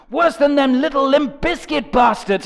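normal talking voice

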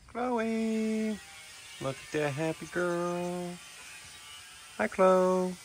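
high, sing-song call to a bird; high excited pet greeting. Real, not acted. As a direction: coo at her like a happy pet.

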